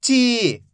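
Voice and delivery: ची with a long, held ee; teacher drawing out ची; drawn-out syllable. Shown as a demonstration. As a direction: stretch the vowel in ची for learners. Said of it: "खींच खींच के पढ़ना है".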